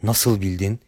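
soft, low confidential question. A narrator's reading. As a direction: drop pitch and volume, ask discreetly. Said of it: "sesini alçalttı".

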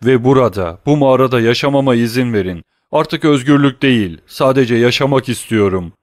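loud desperate begging. A narrator's reading. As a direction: plead to be allowed to live. Starving prisoner begs to live.

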